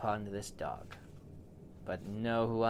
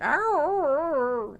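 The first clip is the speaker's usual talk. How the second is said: very high, loud yelp; high loud dog cry of pain; 10 dB louder than usual. Acted. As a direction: yelp like a hurt puppy. Dog yelps from louse.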